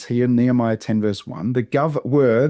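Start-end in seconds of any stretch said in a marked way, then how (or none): none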